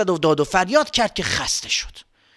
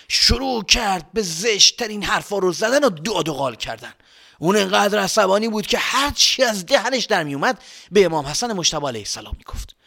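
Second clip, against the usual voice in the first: plain reading voice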